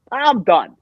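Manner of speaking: emphatic mock announcement; retold declaration. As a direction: put your foot down, retelling it. Retold blunt announcement of quitting.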